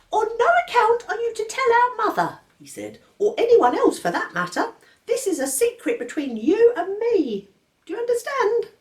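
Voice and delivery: put-on a severe baby laying down the law; stern baby George.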